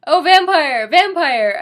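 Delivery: loud, high hammed-up scream of a name. Narrator screaming Vampire's name in the orgy. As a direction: shriek it out mock-passionately. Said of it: "I screamed".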